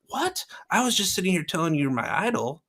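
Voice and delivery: high startled protest; mock shock. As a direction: act stunned and wounded. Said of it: "I'm like".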